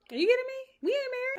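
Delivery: loud, very high indignant outburst; outraged disbelief. Acted. Girlfriend rejecting a six-month wait, outraged. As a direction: throw it back in high-pitched disbelief.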